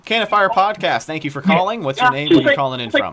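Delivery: bright, upbeat host greeting, pitched high; chirpy over clear scripted. Real, not acted. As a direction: greet the caller like a radio host. Host greets a new caller.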